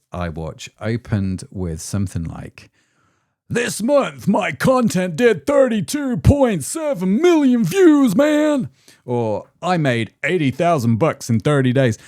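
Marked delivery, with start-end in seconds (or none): 3.5-8.7 high, loud hyped-up American creator voice; American accent. Retold or imitated. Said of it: "with an American accent". 9.6-12.0 put-on a lighter American accent, bragging; mock American creator boast. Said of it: "with an American accent".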